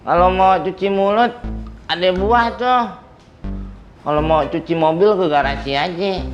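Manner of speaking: usual speaking voice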